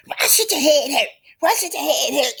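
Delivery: high-pitched incredulous question; challenging retort. Acted. Tisha challenging Destiny's headache complaint, high and incredulous. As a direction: fire it back high and disbelieving.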